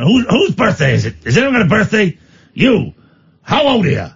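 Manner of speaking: imitated stand-up heckling a punter; Neil Hamburger. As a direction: fire questions at the audience, high and insistent. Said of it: "great bit of the Neil Hamburger show".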